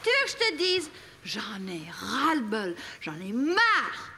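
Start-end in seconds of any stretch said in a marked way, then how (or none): 1.2-4.1 slow weary fed-up. Acted. Wife at end of tether.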